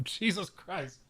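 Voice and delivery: talking through a real nosebleed; high, hushed, nose bleeding. Real, not acted. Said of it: "Oh no, do I have a nosebleed?".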